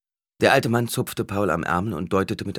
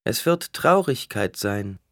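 slow oracle proclamation, slightly raised; solemn intoning; 3 semitones higher, slower than usual. A narrator's reading. Alia begins her oracle before the crowd, solemnly intoning. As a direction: intone it slowly like a prophecy. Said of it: "intonierte Alia".

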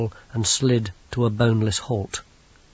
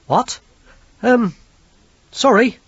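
higher, louder, rattled; flustered voice; 9 semitones higher, 5 dB louder than usual. Acted. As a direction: stumble over it, unnerved. Dave flustered.

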